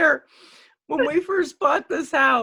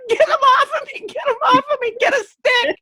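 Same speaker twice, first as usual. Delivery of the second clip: high, louder panicked plea, retold; 11 semitones higher, 4 dB louder than usual. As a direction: re-enact yelling frantically for help. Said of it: "screaming like a little girl".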